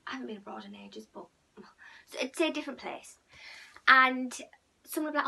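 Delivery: ordinary delivery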